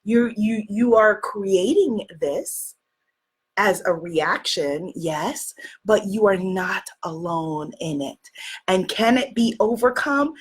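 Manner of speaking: ordinary talk, nothing marked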